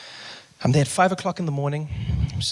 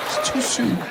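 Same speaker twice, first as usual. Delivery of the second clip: outraged; 10 semitones higher, slower than usual. Retold or imitated. Aside repeating outrage.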